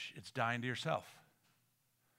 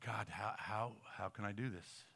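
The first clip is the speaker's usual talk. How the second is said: low, soft, stumbling question; halting imagined prayer; hushed troubled prayer; 4 semitones lower, 8 dB quieter than usual. Acted. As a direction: voice a quiet, helpless plea to God. Acts troubled believer's prayer.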